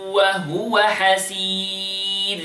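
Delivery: measured tajweed recitation with long vowels; Quran recitation. Acted. Teacher reciting the verse for students to copy. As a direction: recite it like the teacher's model reading.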